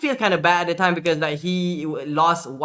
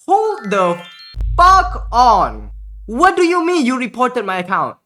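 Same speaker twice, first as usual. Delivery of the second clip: loud, higher, alarmed demand; shocked outburst; 5 semitones higher, 6 dB louder, slower than usual. Retold or imitated. Streamer hearing his account was reported, loud alarmed outburst. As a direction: stop him cold, alarmed and loud. Retold shocked reaction to scammer.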